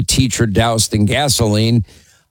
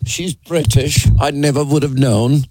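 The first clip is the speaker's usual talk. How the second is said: put-on playful British accent; 4 semitones higher than usual. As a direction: tease with a hint of a British accent. Host mock-echoes British caller's accent.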